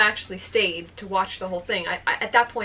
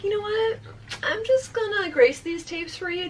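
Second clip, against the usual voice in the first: high, over-sweet clerk voice, retold; smooth it over in a high, nervous chirp; placating; 10 semitones higher than usual. Clerk erases late charges for embarrassed couple, falsely breezy.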